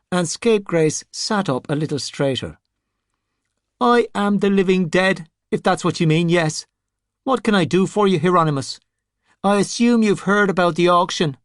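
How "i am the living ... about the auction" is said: higher, self-important voice; a rotting boss puts on airs; pompous zombie. Scapegrace bargains with sorcerers.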